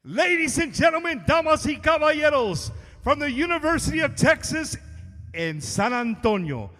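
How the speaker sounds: booming ring announcer intro; lucha libre announcer. Acted. Introduces the band to crowd.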